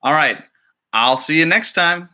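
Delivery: usual speaking voice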